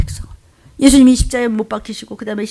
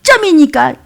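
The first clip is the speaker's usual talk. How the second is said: high, loud mock lament; wail, then grumble on in self-pity; bemoaning one's lot; 5 semitones higher, 4 dB louder than usual. Believer lists offerings to God, bemoaning their lot. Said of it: "네 팔자를 한탄하지 말라".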